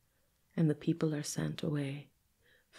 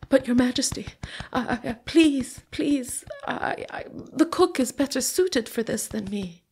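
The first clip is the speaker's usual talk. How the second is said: higher, louder frightened begging; scared pleading; 7 semitones higher, 7 dB louder than usual. A narrator's reading. Fahim begs the king not to make him carve, frightened plea. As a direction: stammer out a panicked plea.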